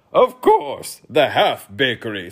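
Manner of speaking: loud retort, starting very high; snapped. A narrator's reading. King snapping at the boy's question, loud. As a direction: snap back loudly. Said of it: "snapped the king".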